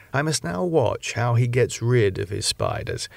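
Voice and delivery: ordinary delivery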